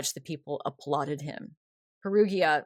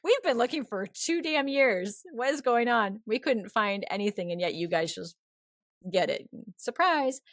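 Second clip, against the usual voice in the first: incredulous; 4 semitones higher than usual. Retold or imitated. France acting out disbelief at the find, mock incredulous. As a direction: play France fed up after two years' search. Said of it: "a little bit incredulous".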